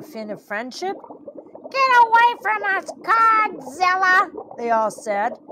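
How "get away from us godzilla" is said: high, loud fish voices; angry rejection. Acted. All the fish reject Birdie's friendship, high angry cry. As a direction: drive him off in a high cry.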